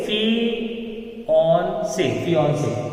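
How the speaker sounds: "फियांसे" drawn out softly as fee-ON-say; soft, syllable-by-syllable "फियांसे", middle vowel like "on"; correct pronunciation. Shown as a demonstration. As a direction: slowly stretch "फियांसे" with a rounded ON in the middle. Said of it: "हम इसे बोलेंगे".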